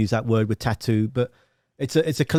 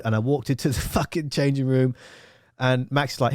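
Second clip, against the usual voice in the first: usual speaking voice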